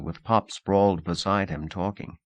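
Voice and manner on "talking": ordinary delivery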